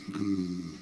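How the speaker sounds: low sleepy "mm"; imitated low, drowsy hum; sleepy grunt. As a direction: grunt as if fast asleep. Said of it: "just like that, and she's like".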